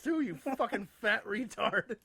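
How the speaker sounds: ordinary delivery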